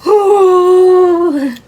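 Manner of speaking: loud, high, drawn-out yawn; big demonstrated yawn, high and loud; sleepy yawn. Acted. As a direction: yawn wide and long. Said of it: "You start yawning".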